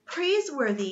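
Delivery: high-pitched excited call; exclaiming. A narrator's reading. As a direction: call out with sudden inspiration. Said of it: "he exclaimed".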